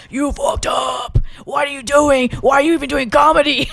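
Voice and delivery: hammed-up anguished shouting at yourself; anxiety brain. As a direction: play the panicking inner critic, yelling in a strained voice. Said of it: "my O C D anxiety brain was like".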